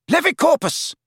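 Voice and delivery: high-pitched incantation; urgent spell cry. Acted. Wizard casting at attacking friend, urgent cry. Harry casts a spell in fight.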